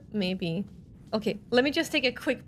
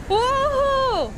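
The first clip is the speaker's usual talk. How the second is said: very high, loud whoop; excited yell; 13 semitones higher, 7 dB louder than usual. Real, not acted. Player reacting to an exciting fight, yelling high. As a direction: yell out in high-pitched amazement. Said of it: "Sorry, I'm yelling again".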